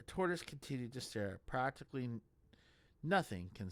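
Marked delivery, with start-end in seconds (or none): none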